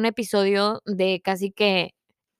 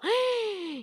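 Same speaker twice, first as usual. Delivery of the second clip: mock gasp; 10 semitones higher, 8 dB quieter than usual. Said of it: "Y yo".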